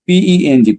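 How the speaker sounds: পিইএনজি spelled out high and slowly, falling at the end; teacher spelling পিইএনজি; spelling demo. Shown as a demonstration. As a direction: spell পিইএনজি letter by letter for the class. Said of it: "উচ্চারণগুলো ভালো মতো শুনেন".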